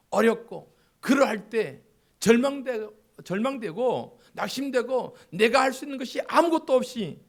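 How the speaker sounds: impassioned preaching. Real, not acted. Preacher pleading loudly to the congregation. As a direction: preach it with fire.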